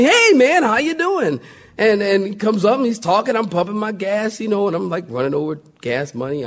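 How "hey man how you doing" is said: high, bright-eyed "Hey, how you doing?"; overly friendly stranger. Retold or imitated. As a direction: play the eager salesman greeting. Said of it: "he's all friendly".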